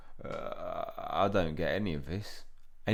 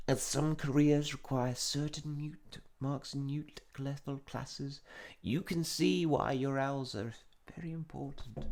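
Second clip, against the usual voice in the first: lightly voiced stern professor, higher, whispering the warning part; a hint of a formal old professor addressing students; Professor Tofty voice; 6 semitones higher than usual. Player reads the professor announcing the exams, a touch stern.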